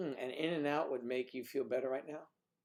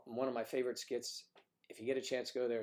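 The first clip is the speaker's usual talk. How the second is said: ordinary talk, nothing marked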